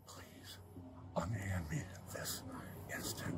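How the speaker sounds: hushed unvoiced whisper. Real, not acted. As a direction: breathe the demand in a whisper. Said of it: "I'm gonna whisper to you".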